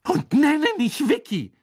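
very high, loud yell; furious outburst. Acted. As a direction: explode at the taunting nickname. Hermine explodes before storming off.

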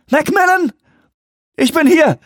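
high, loud searching call. Acted. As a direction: shout to be found. Calling through flooded lab.

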